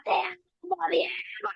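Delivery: tender high call to Diego; high, soft love cry. Retold or imitated. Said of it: "Le gritaba". (te amo Diego, agudo y suave)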